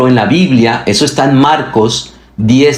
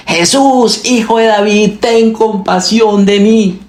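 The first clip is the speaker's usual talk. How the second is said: crying out; 9 semitones higher than usual. A narrator's reading. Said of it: "comenzó a gritar".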